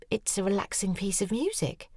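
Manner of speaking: a hint of a defensive girl; shop girl's voice. A narrator's reading.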